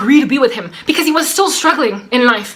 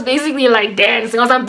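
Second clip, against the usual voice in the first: usual speaking voice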